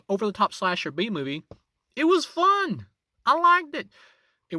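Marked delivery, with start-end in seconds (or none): 2.0-3.9 excited. Real, not acted. Enthusing about the movie.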